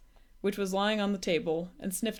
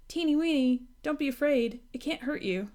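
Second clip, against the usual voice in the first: coaxing; 6 semitones higher than usual. A narrator's reading. Coaxing the dragon.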